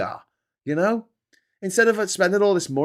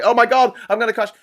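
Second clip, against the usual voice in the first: higher, louder panicked outburst; mock panic; 6 semitones higher, 6 dB louder, faster than usual. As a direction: act out sudden parking panic. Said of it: "And I'm like".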